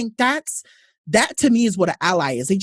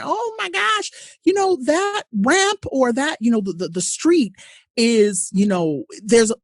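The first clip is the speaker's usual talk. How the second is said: put-on high, whiny child voice, louder at the end; hurt child; 8 semitones higher, 3 dB louder than usual. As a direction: play a small kid complaining about a fall. Voicing a crying hurt child.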